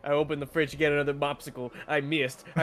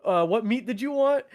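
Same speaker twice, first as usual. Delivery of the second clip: higher, chirpy clerk question; mock clerk voice; 7 semitones higher, 3 dB louder than usual. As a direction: play the cheery sandwich worker. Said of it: "And they're like".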